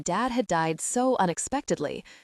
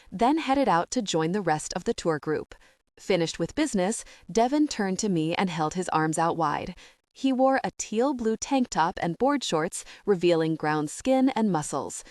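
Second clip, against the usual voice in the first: ordinary delivery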